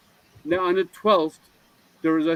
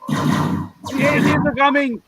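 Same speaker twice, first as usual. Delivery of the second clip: high, drawn-out mock warning cry; mock alarm shout; 9 semitones higher, 4 dB louder, slower than usual. Joker warning friends of an alien invasion, mock-alarmed shout. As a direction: sound a slow, high-pitched false alarm.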